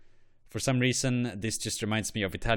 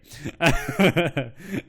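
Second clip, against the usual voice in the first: laugh; 6 semitones higher, 7 dB louder than usual. Real, not acted. Bursts out laughing at new flag.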